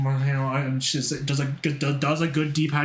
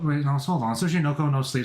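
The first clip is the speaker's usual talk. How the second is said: usual speaking voice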